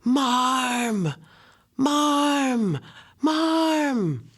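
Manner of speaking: high, loud desperate scream for mother. A narrator's reading. Little brother screaming for his mother from the wharf, high and loud. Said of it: "I heard him scream".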